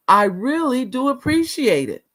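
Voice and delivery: high-pitched, emphatic show of appreciation; lifted voice, presenting the line to the room; for the back row. Shown as a demonstration. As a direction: demonstrate it for the folks in the back. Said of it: "for the folks in the back".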